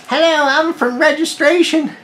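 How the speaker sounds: very high, slow singsong greeting; mock a hospital clerk's drawn-out hello; registration clerk voice. Voices chirpy registration clerk to patient.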